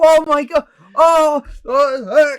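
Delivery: very high and loud, squealing in delight; excited squeal. Retold or imitated. Friends reacting at the pub to the view count, shrieking happily. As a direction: scream it like the best news ever.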